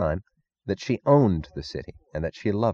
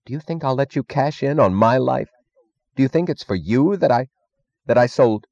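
higher-pitched indignation; outraged demand; 6 semitones higher than usual. A narrator's reading. Stadler challenging Meggs over his life, outraged. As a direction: raise the pitch in rising outrage.

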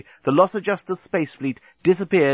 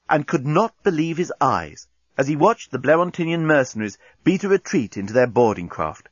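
ordinary delivery

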